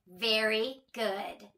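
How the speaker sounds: plain reading voice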